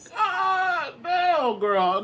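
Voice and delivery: high, far-off squeal of God damn; high squealed praise. Retold or imitated. To viewers, squealing praise of Marie on the steps. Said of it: "I said".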